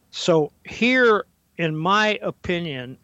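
ordinary talk

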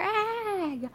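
short high startled scream. Acted. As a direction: yelp in sudden fright. Kids scream in fright.

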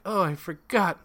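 high, lilting mock-aunt voice; hammed-up sing-song scatterbrain; sing-songy aunt imitation. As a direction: flutter up high like a dotty aunt. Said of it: "everything she said was all sing-songy".

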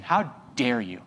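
low, slow, hushed "how dare you"; mock indignation. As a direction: mutter it as a wounded churchgoer. Mocking the offended believer.

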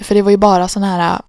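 ordinary delivery

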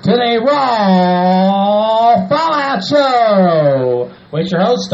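slow, drawn-out ring announcer voice; announcer intro. Acted. Host opening the wrestling show, announcer style. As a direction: stretch it out like a showman.

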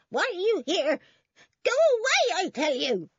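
high, loud screeched demand; screaming old woman. A narrator's reading. Old woman confronting two policemen, screeching high. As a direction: screech at them to leave. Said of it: "screamed the old woman".

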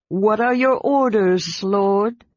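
slow, pointed dragon voice; the dragon presses his rider for orders; impatient dragon. A narrator's reading. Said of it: "Sky asked impatiently".

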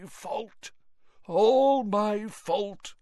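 high, far-gone, dragging the words; raving Mr Crouch, drawn out; delirious old man, slow and rising. Acted. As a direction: mumble it like a broken man.

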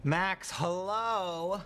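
drawn-out hello. Acted. Friend greets Max to be noticed, drawn-out hello. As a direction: draw the greeting right out. Drawn-out call to get attention.

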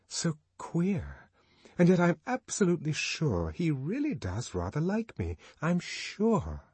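put-on young woman voice. Lenina's private hopeful thoughts.

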